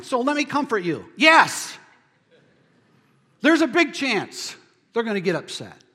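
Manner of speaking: loud, high, emphatic sermon delivery; preaching at full force. Real, not acted. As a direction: drive the point home to the congregation.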